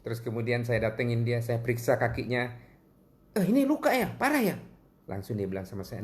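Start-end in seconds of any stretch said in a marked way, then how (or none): 3.3-4.5 high-pitched acted concern; concerned questions. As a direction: voice jumps up, checking on an injured person. Acts out examining the injured foot.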